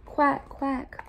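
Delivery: lightly voiced storybook quacks; duck's quack. A narrator's reading. Reading the duck to kindergartners, light quacks. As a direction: quack like a picture-book duck. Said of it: "called the duck".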